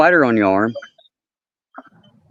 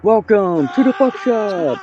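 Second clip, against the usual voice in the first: announcer voice; 10 semitones higher than usual. Acted. Announcer greeting, slow high.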